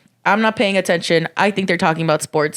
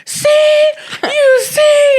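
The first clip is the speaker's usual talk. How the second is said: much higher, louder hammed-up shout; mock yell; 6 dB louder, much slower than usual. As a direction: yell like he's proved his point. Boyfriend yelling triumphantly.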